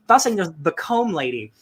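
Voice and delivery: usual speaking voice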